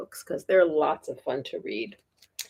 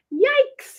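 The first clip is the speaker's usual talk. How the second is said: high, loud yelp; loud, high "Yikes"; startled yell; 6 dB louder than usual. Acted. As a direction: jump at the cold touch. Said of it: "yelled Froggy".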